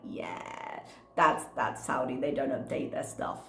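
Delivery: low, soft deadpan answer; imitating a matter-of-fact shrug; resigned reply. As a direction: drop low and quiet, resigned. Head's resigned answer, acted out.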